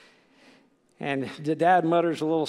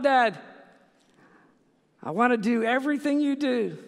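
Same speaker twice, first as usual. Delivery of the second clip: put-on little boy voice; 8 semitones higher, 4 dB louder than usual. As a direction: play the eager four-year-old. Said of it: "he's just all about".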